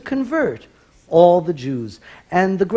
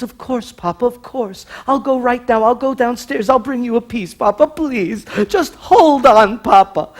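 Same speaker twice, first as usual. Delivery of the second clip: eager son; 6 semitones higher, 4 dB louder than usual. Acted. Son reassures dying father.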